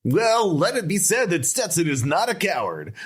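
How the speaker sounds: high-pitched albatross familiar; high cartoon critter declaration; Stetson. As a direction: proclaim it in a high put-on bird voice. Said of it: "what was his voice like".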